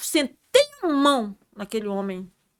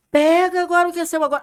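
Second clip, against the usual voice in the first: fast, high, loud command; loud rebuke; 7 semitones higher, 7 dB louder, faster than usual. Retold or imitated. Rebuking Satan in the church, loud fast command. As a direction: throw it at the devil like an order. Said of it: "Aí eu falei alto com Satanás".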